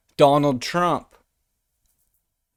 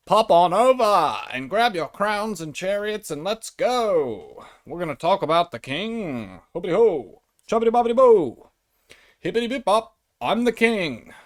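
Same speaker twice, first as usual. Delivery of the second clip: high, swooping British whooping; rally them as a silly jolly Brit; hammed-up caricature royal cheer; mock British royalist; 4 semitones higher than usual. Returns to royalist voice for plug.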